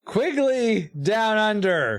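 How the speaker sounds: Quigley Down Under, high and drawn out; insistent correction. Real, not acted. Host correcting co-host's film choice, Quigley Down Under said slowly and emphatically.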